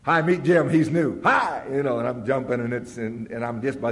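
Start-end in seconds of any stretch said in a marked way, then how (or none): 0.0-1.7 louder, perky imitated host; mock eager introduction. Tom introducing newcomer Jim to everyone, perky mimicry. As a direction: bounce through it like a cheery introducer. Sponsor introducing him around.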